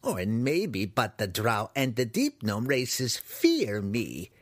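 slow, self-absorbed crazed wizard; musing. Acted. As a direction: think aloud, lightly voiced as a batty hermit. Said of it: "the human mused".

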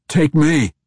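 loud, high, urgent shout. A narrator's reading. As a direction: shout it desperately to the captor. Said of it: "Entreri shouted".

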